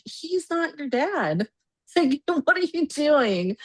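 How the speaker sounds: high, incredulous reproach; retold disbelief. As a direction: stretch it out high in mock disbelief. Retelling her disbelief at mom.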